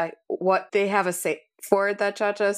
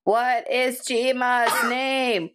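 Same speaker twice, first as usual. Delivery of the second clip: higher, drawn-out interrogating yell; mock yell; 6 semitones higher, slower than usual. Cha-Cha yelling at Jeski in morning questioning, mock yell. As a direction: stretch it out as a restrained acted yell. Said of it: "like, just yells at her".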